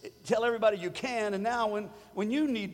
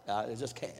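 lower, softer, mock hopeless can't; mock defeated sigh; 10 semitones lower, 7 dB quieter than usual. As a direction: play the defeated believer, deflated. Voicing defeated believer.

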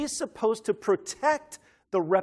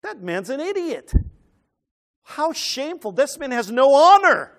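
higher, scornful, swelling to a loud finish; indignant Pharisee; 7 semitones higher, 7 dB louder than usual. Acted. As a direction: sneer at the foolish father, then let it burst out. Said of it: "what are they thinking now?".

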